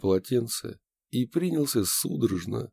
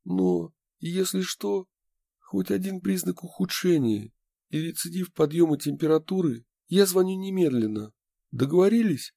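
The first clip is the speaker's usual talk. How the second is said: worried insistence; 10 semitones higher than usual. A narrator's reading. Worried friend sets condition.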